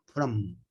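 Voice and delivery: from, lips clamped shut on the M; exaggerated closed M. Shown as a demonstration. Teacher exaggerating the M for the class. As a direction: overdo the closing of the lips on from. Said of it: "Acá estoy exagerando".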